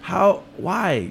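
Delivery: high-pitched, pleading dismay; retold helpless frustration; mock exasperation. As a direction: plead in disbelief, playing it up. Retells own dismay at complaints.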